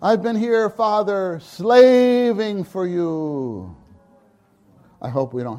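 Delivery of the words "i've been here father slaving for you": very slow, loud whine; older son pitying himself; whiny grievance. Acted. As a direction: stretch out slaving.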